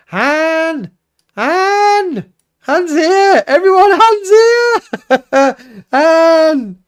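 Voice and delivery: high, loud, drawn-out calls of welcome; excited shout. Real, not acted. Greeting a friend who just joined the chat, calling out with loud delight. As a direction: yell it out with delight as a friend arrives.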